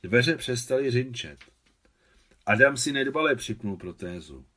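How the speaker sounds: usual speaking voice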